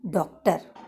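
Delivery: डॉक्टर with the vowel between आ and ओ; English o sound. Shown as a demonstration. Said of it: "बल्कि उनके बीच का ये होगा".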